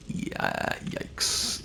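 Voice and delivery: a stunned whisper; whispered in disgust at dark lore; whispered yikes. Real, not acted. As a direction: breathe it out, appalled. Reacting to stillborn item lore.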